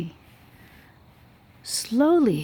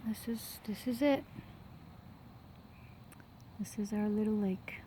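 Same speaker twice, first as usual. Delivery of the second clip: whisper; 9 dB quieter than usual. Real, not acted. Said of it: "whispered lake reveal".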